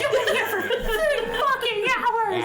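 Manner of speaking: high, slow squeaky rant; kobold yelling. Acted. Kobold scolds lingering patrons, slow, high and loud. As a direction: drag it out, outraged and high. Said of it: "he's on top of the table".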